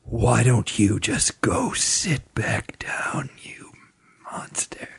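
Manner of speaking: harsh whisper. Acted. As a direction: whisper it with contempt. Announcer hisses at the winner.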